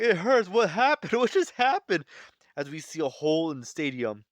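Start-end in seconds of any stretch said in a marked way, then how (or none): 0.0-2.0 high, loud, frantic confusion; wounded god realizing he's hit, panicking loudly; panicked whimper. Acted. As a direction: babble it in pain and shock.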